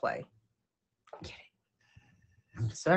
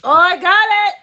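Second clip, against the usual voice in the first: excited; 10 semitones higher, 9 dB louder, slower than usual. Real, not acted. Teasing the dog.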